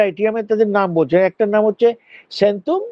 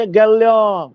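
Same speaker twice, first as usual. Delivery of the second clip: Birbhum dialect; 2 semitones higher, much slower than usual. Shown as a demonstration. Teacher imitating the Birbhum form for students. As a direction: mimic a Birbhum villager saying গেলাম. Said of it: "কি হচ্ছে?".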